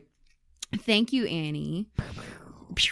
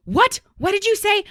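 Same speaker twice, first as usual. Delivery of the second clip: indignant caller; 11 semitones higher, 4 dB louder than usual. Retold or imitated. Angry caller can't understand.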